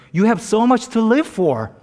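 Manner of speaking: acted urgent appeal; earnest pleading. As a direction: talk someone back from the edge. Joke pastor pleads with jumper.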